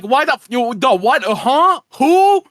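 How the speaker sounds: mock bewildered double-take; baffled surprise. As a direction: react like a monkey just appeared from nowhere. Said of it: "'Cause it's just like".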